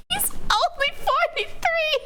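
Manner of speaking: breathless giggling whisper, very high; whispered laughing. Real, not acted. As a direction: gasp it through giggles.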